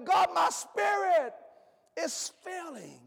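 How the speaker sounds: slow, stretched, falling to almost nothing; drawn-out refrain. Acted. Preacher's refrain to the congregation, slow and fading. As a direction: let the line sink and die away.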